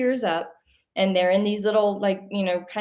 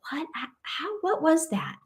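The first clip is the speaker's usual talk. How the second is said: higher, hushed, stunned question; bewildered; 8 semitones higher, 3 dB quieter than usual. Retold or imitated. Back on the couch after the horses vanished, stunned and higher. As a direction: ask it amazed and lost for words.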